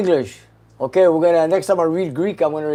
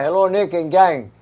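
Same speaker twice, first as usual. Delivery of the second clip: usual speaking voice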